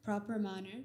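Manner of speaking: ordinary talk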